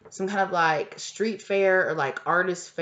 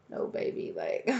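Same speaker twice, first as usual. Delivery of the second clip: seductive whisper; 5 semitones higher, 6 dB quieter than usual. Retold or imitated. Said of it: "this person's like".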